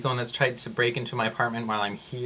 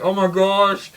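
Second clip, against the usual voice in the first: high, loud mock horror; embarrassed exclamation; 8 semitones higher, 10 dB louder, slower than usual. Retelling being overheard at work, mortified exclamation. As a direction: cry out as if mortified. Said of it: "I felt really embarrassed".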